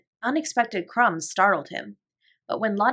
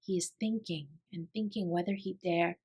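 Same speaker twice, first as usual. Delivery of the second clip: very soft, hushed voice; hushed whisper; 10 dB quieter than usual. A narrator's reading. Sarah whispers to Lottie about the sparrow, very softly. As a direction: keep it hushed so the bird stays. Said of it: "Sarah whispered back".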